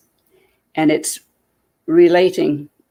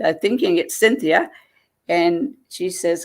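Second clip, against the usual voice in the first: ordinary delivery, nothing marked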